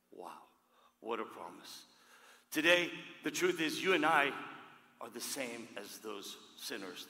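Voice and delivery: ordinary delivery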